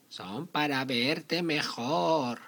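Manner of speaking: high, very slow granny voice; wolf faking grandma sweetly; fake grandma voice.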